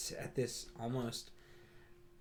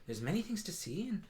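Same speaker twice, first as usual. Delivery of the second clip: higher, bright-pitched welcoming shop cat; Moon the Tabaxi shopkeeper; 5 semitones higher, faster than usual. Acted. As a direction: greet customers grandly in a high voice. Moon keeps serving customers.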